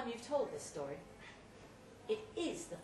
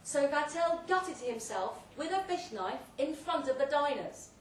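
louder and higher, orating to the guests; booming tale told through a loud hailer; grand loud speech; 4 semitones higher, 6 dB louder than usual. Acted. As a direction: hold forth like a host at a banquet.

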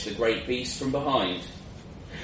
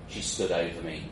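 plain reading voice

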